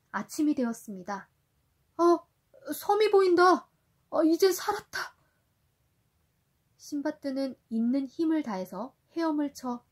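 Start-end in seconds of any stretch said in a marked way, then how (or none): 2.0-5.2 high, loud cry of relief; relieved joy. Acted. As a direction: shout with glad relief. Sindbad sees an island. (살았다는 안도의 외침)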